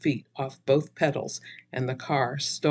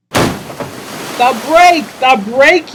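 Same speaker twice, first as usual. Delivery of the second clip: loud, high repeated yell; 10 semitones higher, 11 dB louder, much slower than usual. Acted. Donnie yelling as he runs toward the car, loud. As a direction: shout it as the car rolls back. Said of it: "yelled Donnie".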